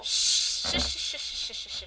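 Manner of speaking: long shh, then a string of short ones; urgent shushing. Real, not acted. Co-host hushes her friend for oversharing, shushing. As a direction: shush them repeatedly to cut them off. Hushing co-host about American Girl.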